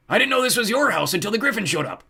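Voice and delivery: high, loud goblin protest; shouted excuse, pitched high; defensive goblin. A narrator's reading. Goblin defends himself in quarrel.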